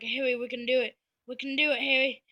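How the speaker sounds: rallying troops. Retold or imitated. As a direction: cheer your buddy on in a cartoon voice. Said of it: "these woods are like".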